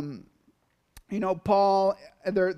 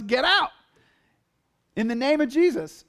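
raised, higher-pitched retold angry command; imitated angry outburst, pitched up; upset and angry; 5 semitones higher, 4 dB louder than usual. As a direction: act out an exasperated order to a demon. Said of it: "He was, he was upset and angry".

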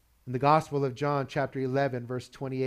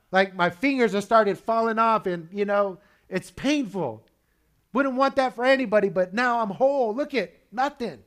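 raised pitch, eager retelling at the table; excited testimony; 7 semitones higher, 5 dB louder than usual. Acted. Healed leper telling dinner guests what happened, excited. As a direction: tell it like an amazed, healed survivor. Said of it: "how excited he was to tell people".

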